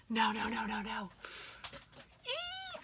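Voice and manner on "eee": piercing high-pitched squeal of delight. Real, not acted. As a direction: let out a thrilled squeal. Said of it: "I'm squealing".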